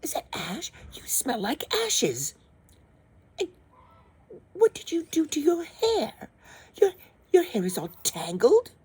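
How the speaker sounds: high, snobbish, scolding prince; snooty prince. Acted. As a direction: sneer at her clothes. Prince scolding her looks.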